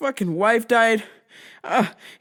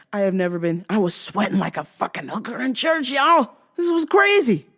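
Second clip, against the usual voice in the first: normal talking voice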